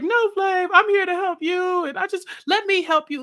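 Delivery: imitating an earnest plea, pitched high; high, insistent hammed-up begging; mock pleading. [name] begs [name] to let her help, mock pleading.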